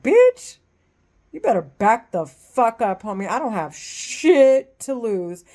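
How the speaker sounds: loud, high challenge that settles into a hard growl; furious threat. Real, not acted. Woman telling a creep to back off, loud and furious. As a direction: tell him to back off like you mean it. Threatening the man harassing her.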